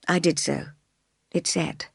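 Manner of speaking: usual speaking voice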